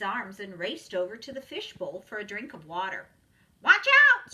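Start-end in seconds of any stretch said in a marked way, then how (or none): 3.6-4.3 very high, loud parrot screech. A narrator's reading. Parrot warning the puppy, loud high screech. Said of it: "screeched the parrot".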